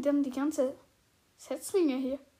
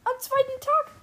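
high, loud, excited confirmation; thrilled boast; 9 semitones higher, 8 dB louder than usual. Real, not acted. Player confirms taming a dog to friend, thrilled. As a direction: crow it proudly to your friend.

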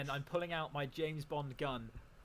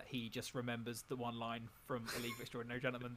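ordinary talk

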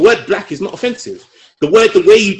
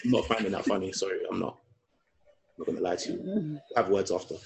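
quiet; 7 semitones lower, 13 dB quieter than usual. Real, not acted. Offended, muttering quietly.

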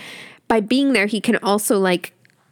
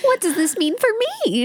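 voicing Ava; 11 semitones higher than usual. Voicing Ava's question.

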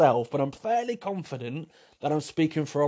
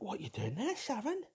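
mock baffled friend; 8 semitones higher, 12 dB quieter than usual. Friend questions Sharon's pout.

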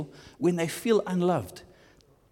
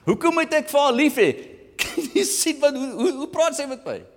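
a hint of higher, faster squabbling voices; mock bickering couple; 8 semitones higher than usual. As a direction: trade complaints like a stuck couple. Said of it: "vasgevang is van".

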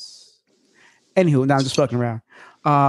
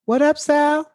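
high hyped crowd echo; special effects voice; 10 semitones higher than usual. Pretend. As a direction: echo him like a cheering crowd. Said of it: "That's my special effects".

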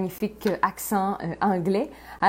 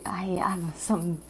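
a hushed private aside, voice gone breathy and unvoiced; whispered aside; 5 dB quieter, slower than usual. Real, not acted. Host whispering to himself before going live, hushed aside. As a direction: whisper it half to yourself.

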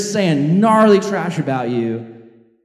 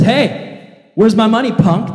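louder, hammed-up thug shake-down; tough demand; 4 semitones higher, 5 dB louder, slower than usual. Forgiven man shaking down his debtor, mock tough. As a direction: lean on him like a street tough. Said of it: "he says".

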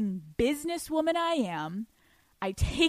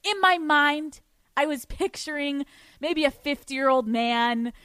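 normal talking voice

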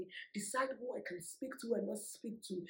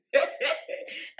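breathy laugh, pitched up; airy laugh; 6 semitones higher, 11 dB louder than usual. Real, not acted. Laughing after her sassy line to the ex. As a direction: laugh it off lightly.

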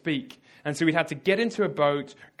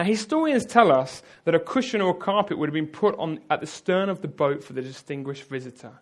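ordinary delivery

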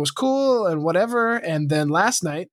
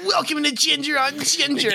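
put-on high, pinched whiny-kid intro; whiny child voice; 10 semitones higher than usual. Podcast host greeting listeners in a whiny child voice. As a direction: open the show as a whining child. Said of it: "whiny child voice".

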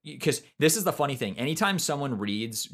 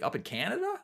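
quoting a baffled man, sharply rising pitch; retold incredulous question, higher at the end; imitated puzzled surprise; 6 semitones higher, 4 dB quieter than usual. Bookseller baffled by caller's location.

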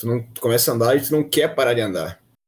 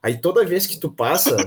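ordinary talk

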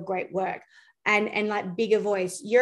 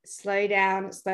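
ordinary talk

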